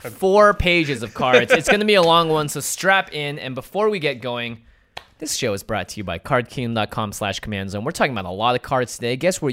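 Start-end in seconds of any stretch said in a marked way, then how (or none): 0.1-3.1 dramatic. Pretend. Dramatic warning.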